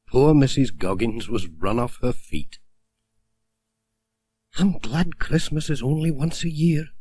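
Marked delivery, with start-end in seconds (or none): 4.6-6.9 lightly raised, higher postmistress voice; a tired village postmistress, voiced higher. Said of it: "she said".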